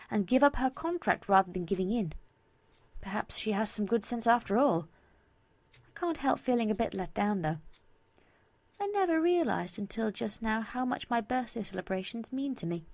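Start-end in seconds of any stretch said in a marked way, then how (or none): none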